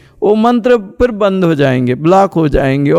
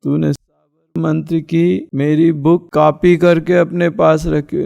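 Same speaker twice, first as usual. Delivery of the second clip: ordinary delivery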